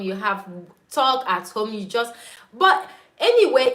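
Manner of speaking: ordinary talk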